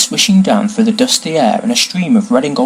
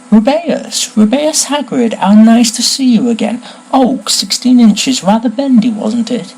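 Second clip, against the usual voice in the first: high, exclaiming wand seller's welcome; delighted greeting; 11 semitones higher, 3 dB louder than usual. Acted. Shopkeeper spots an old customer, delighted high exclamation.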